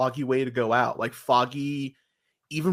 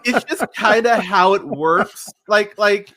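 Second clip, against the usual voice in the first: higher, louder, laughing through the words; 8 semitones higher, 7 dB louder than usual. Real, not acted. Host to fans complaining about Foggy, laughing. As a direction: chuckle while making the point.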